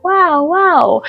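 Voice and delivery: high, drawn-out wows of wonder; awed wow. Retold or imitated. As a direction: marvel aloud in a high-pitched swoop. Retelling awe at temples.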